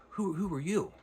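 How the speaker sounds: high, startled question; puzzled question. Retold or imitated. Valjean asks the stranger.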